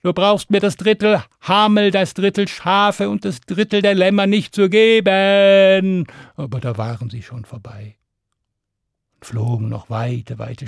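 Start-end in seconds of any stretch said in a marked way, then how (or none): 0.0-6.1 louder, higher call down from the air. Acted. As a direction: call down to the shepherd below. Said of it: "und Stan winkte hinunter und rief".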